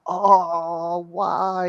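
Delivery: high, groaning oh why; mock-complain like kids held back; whiny protest. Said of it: "And we'd be like".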